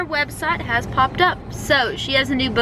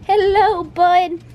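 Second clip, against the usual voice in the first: greeting the dog; 6 semitones higher, 4 dB louder, slower than usual. Real, not acted.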